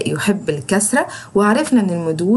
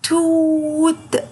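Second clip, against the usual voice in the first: very slow توت, higher, oo held; long drawn vowel; 7 semitones higher, much slower than usual. Shown as a demonstration. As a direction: stretch the oo in توت. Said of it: "شايفين بقي عمل ازاي".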